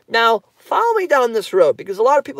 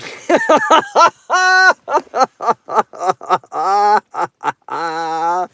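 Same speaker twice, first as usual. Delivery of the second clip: long real laugh. Real, not acted. Laughing at a viewer comment.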